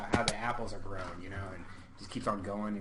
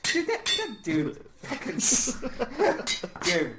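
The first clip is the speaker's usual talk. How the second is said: laughing; 13 semitones higher, 6 dB louder, slower than usual. Real, not acted. Laughing at the question.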